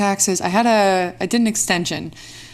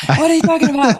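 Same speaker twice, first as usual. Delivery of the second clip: indignant disbelief; 8 semitones higher, 5 dB louder than usual. Acted. Mock outrage continues.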